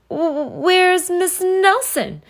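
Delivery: high, stammering and slow; nervous kids' question. Acted. Scared kids asking the strict teacher. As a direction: ask timidly, stumbling on the first word. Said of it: "asked the kids".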